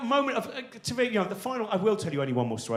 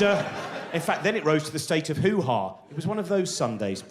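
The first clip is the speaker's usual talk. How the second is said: ordinary talk, nothing marked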